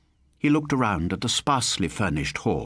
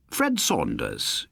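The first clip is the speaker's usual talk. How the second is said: beaming announcement; 7 semitones lower, slower than usual. A narrator's reading. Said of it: "he announced, still beaming".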